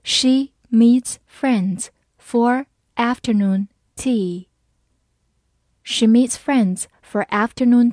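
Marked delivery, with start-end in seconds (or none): 0.0-4.5 slow, word by word; slow reading. Shown as a demonstration. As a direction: read it slowly for learners, pausing between words. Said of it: "好，慢慢来读".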